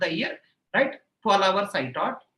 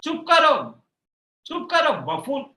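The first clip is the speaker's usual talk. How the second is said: angry retort; 5 semitones higher, 5 dB louder than usual. Retold or imitated. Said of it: "He said".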